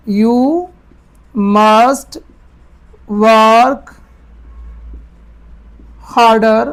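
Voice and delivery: each word set apart with long pauses; slow word-by-word dictation. Shown as a demonstration. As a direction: dictate one word at a time. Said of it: "तो उसको ही पहले ले आओ".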